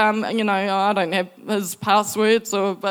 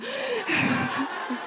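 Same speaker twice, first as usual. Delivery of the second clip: delighted laugh; 7 semitones higher, 10 dB quieter than usual. Real, not acted. Delighted laugh at God's details.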